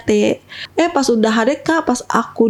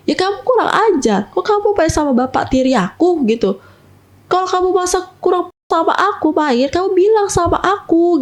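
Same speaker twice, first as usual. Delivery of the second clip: put-on higher pitched, reproachful boyfriend; imitating a jealous boyfriend's scolding, voice pitched up; angry scolding; 7 semitones higher than usual. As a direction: scold her, hurt and accusing. Boyfriend scolding in retold quarrel.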